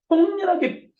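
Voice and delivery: high, loud emphatic burst; forceful. Shown as a demonstration. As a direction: punch it out at the top of the pitch. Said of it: "그건 강력하게 해야죠".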